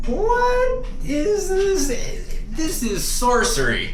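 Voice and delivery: high, very slow awed voice; drawn-out high mock wonder; mock amazement. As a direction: marvel at it like it's magic. Gawking at a marvel, acting amazed.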